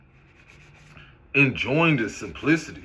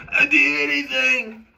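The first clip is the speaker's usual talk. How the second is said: high whiny "I'll do anything" again; mock desperate plea; 11 semitones higher than usual. Mocking eager followers in a high whiny plea. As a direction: beg it in a whiny voice. Second take, same mock.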